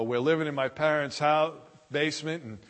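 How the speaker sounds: usual speaking voice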